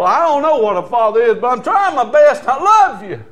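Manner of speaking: building to a loud, high declaration; impassioned retelling of a father's appeal; heartfelt plea. Retold or imitated. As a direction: pour out your love to your child. Retells heartfelt letter to daughter.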